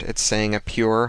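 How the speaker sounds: plain reading voice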